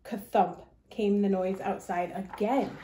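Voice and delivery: plain reading voice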